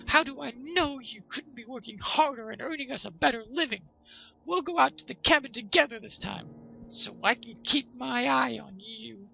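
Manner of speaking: high, loud suspicious wife voice; nagging wife. A narrator's reading. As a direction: keep a sharp eye on him, nagging. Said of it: "said the wife".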